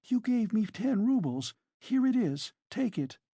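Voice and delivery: high-pitched anxious pleading; timid, frightened character voice; mild terror. A narrator's reading. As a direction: hand it back with understated fright. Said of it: "Sonia whispered in terror".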